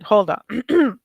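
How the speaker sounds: ordinary talk, nothing marked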